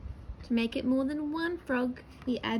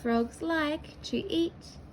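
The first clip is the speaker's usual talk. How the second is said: drawn out word by word; slow read-back; slower than usual. Shown as a demonstration. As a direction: read the whole sentence slowly. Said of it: "Full stop on the end".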